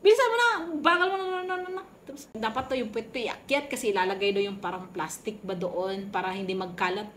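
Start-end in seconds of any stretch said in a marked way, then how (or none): 0.0-1.8 higher, fast nagging; angry staff hurrying her along; angry rushing. Retold or imitated. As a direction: rattle off the impatient nagging. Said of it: "galit talaga sila".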